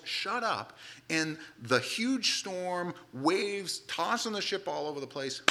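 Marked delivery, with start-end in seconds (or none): 0.0-0.8 high snapped shut up; pitched-up mock yell. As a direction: bark the order like a master. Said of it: "he just yells".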